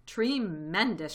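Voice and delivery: loud, stretched-out cheer; shouted. A narrator's reading. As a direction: shout it slowly, with relish. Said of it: "shouted Badger".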